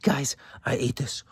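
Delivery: imitated small child. Child confessing to his family, lightly imitated, hushed.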